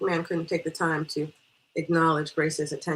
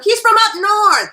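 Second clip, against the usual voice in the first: high, loud, drawn-out holler; calling out news; 14 dB louder than usual. Acted. Guest telling absent friend of the stranger, excited shout. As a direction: announce it loudly to someone in another room.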